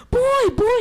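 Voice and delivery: put-on high, loud childish yell; excited yell. Little wolf running into the cave, high excited yell. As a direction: shout it running in. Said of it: "he yelled".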